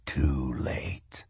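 breathy, menacing whisper. A narrator's reading.

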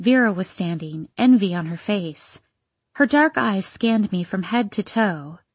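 ordinary delivery, nothing marked

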